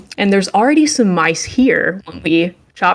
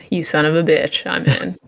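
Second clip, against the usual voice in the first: normal talking voice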